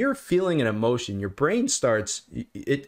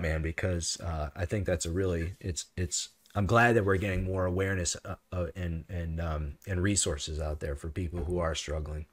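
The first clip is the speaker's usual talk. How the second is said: still choked up, softer; 5 semitones lower, 5 dB quieter than usual. Real, not acted. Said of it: "I am getting choked up".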